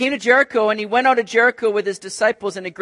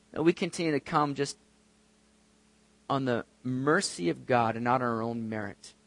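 normal talking voice

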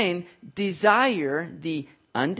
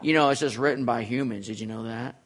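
lower, mumbling mock of a doubter; mock a dismissive sceptic, lower and muttered; 7 semitones lower, 4 dB quieter than usual. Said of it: "how the world loves to say".

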